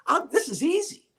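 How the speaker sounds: cocky inner voice. Retold or imitated. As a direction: sure it'll be a breeze. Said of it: "this is what I'm thinking".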